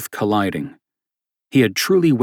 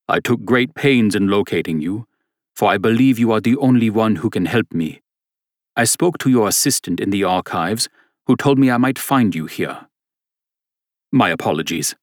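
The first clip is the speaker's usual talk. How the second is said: put-on earnest German accent; 2 semitones higher than usual. Stranger begging priest for help, earnest German accent. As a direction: press your case in a thick German accent. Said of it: "said a man with a thick German accent".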